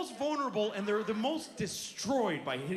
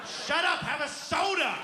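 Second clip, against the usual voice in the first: loud, higher snapping shout; mock mom yelling; 5 semitones higher, 4 dB louder than usual. Mother yelling at the complaining kid. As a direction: shut the kid up harshly. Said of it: "mother yelling at kid".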